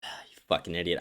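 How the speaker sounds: sighing, low and under the breath; soft, weary exasperation after a sigh; exasperated. Real, not acted. Host to a viewer asking for proof yet again, exasperated.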